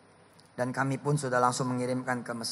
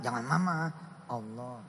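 usual speaking voice

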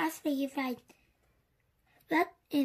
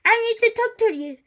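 high, loud, fast call; 7 semitones higher, 8 dB louder, faster than usual. A narrator's reading. Teddy needs to talk to Andy, calling out. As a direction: call out urgently across a distance. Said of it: "he called".

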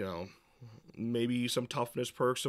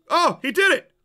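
sudden high-pitched excited shout; 13 dB louder than usual. Real, not acted. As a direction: burst out in delight. Call finally answered.

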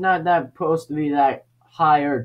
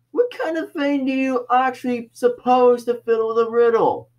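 ordinary talk

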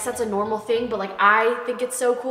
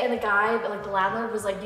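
ordinary delivery

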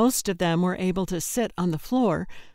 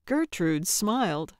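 plain reading voice

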